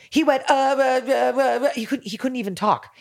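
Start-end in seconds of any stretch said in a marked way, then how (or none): 0.5-1.7 mock stammer, pitched up; stuttering critic. Retelling how the critic stammered on the phone, mock stammer. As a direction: play the critic tongue-tied and stammering. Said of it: "He just stuttered".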